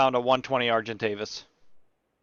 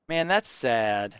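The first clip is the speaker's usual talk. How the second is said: usual speaking voice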